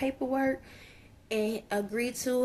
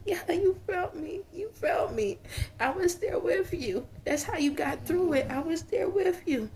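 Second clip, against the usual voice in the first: higher, crying insistence; tearful channelled voice; 6 semitones higher than usual. Acted. Spirit assuring family he was there, tearful and high. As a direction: plead through tears. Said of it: "He's crying now, too".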